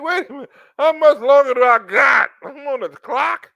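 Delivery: high, loud panicked plea; mock desperate shout. Guy pleading with the woman, panicked loud shout. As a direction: yell like you're out of time.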